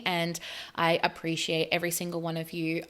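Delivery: ordinary delivery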